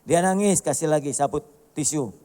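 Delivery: ordinary delivery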